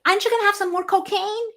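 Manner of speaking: high, fast coaxing offer; mock party host. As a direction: play a pushy guest offering seconds. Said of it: "and say".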